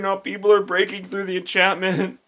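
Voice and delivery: high, loud, distraught outburst; panicked unicorn. Acted. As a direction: wail in overwhelmed protest. Unicorn panics about enchantment breaking.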